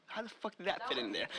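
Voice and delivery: ordinary talk, nothing marked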